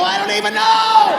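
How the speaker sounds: flustered squeak. Acted. Dad flustered by son's question.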